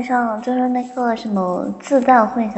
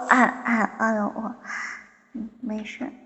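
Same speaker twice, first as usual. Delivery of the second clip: ordinary talk, nothing marked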